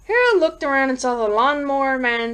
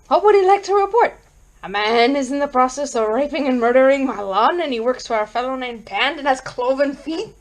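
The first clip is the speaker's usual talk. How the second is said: frantic report; 3 semitones higher than usual. Acted. Frantic man reporting his lawn's murder to police, frantic. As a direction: blurt it out in rising disbelief.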